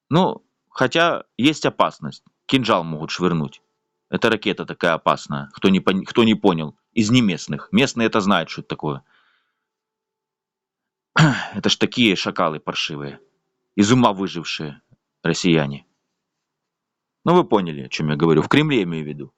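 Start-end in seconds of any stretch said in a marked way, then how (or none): none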